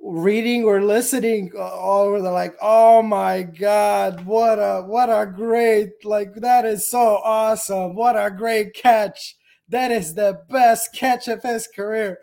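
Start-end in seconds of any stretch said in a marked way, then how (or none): 2.6-12.2 slow, drawn-out mock fan raving; mock gushing. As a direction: gush sarcastically, stretching the praise out. Mocks gushing comments about the catch.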